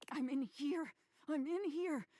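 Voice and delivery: high desperate yell; screaming for help. Acted. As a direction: scream to be let out. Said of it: "you scream".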